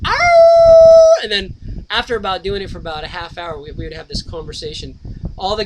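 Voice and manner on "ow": long high howling "Ow" up at the sky; calling to the wolves in the canyon, a loud wolf howl. Retold or imitated. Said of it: "all the wolves in the canyon would go".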